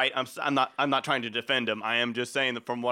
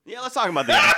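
laughing; 7 semitones higher, 7 dB louder than usual. Real, not acted. Laughing at the foot fetish talk.

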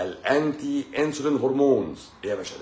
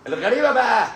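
excited reveal; 12 semitones higher, 7 dB louder than usual. Real, not acted. Excited reveal of a surprising fact.

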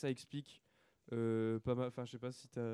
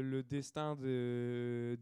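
ordinary talk

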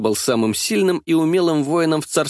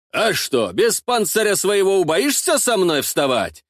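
higher-pitched goading question; provoking; 6 semitones higher than usual. A narrator's reading. Ostap goads his rival to fight without armour, provoking. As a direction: goad the opponent into taking the dare. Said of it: "провоцировал Остап".

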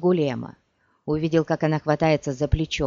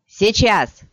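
loud, raised urgent shout; shouted signal; 4 semitones higher, 6 dB louder than usual. A narrator's reading. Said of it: "крикнул Геральт".

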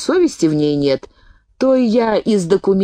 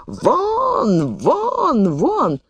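high, strained cries of get out; hammed-up yell; 9 semitones higher, much slower than usual. The gentleman yelling at the woman to get out, a high mock yell. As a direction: bellow him out of the flat, pitched high. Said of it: "орёт".

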